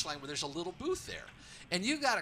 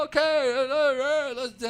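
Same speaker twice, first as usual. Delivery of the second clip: high, loud flustered stammer of uh's; imitating Calabro stalling; 9 semitones higher, 10 dB louder, slower than usual. Host mimics Dave Calabro stalling on the PA, high and flustered. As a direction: play the announcer stuck for words, much higher. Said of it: "So now Calabro's".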